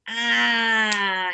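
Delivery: loud sudden aha exclamation; mock aha cry. Retelling the moment the statement clicked, loud aha.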